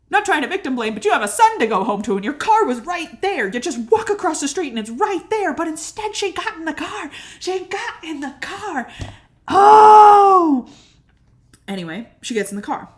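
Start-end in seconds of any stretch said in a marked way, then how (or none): 0.1-8.9 high-pitched, worked-up protest; exasperated rant. Real, not acted. Storyteller scolding the woman who got in the car, exasperated. 9.5-10.7 very loud, high drawn-out "oh"; exasperated shout. Real, not acted. Fed up that she got in the car, exasperated shout. Frustrated outburst ending the rant.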